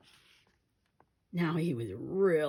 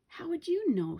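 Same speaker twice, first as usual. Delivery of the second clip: sassy retort; 12 semitones higher, 5 dB louder than usual. A narrator's reading. Annoyed sister retort.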